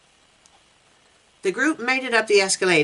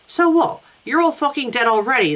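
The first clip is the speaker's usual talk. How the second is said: high, aggressive threat; angry shout; 7 semitones higher than usual. A narrator's reading. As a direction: snap it at them. Hostile man threatens group.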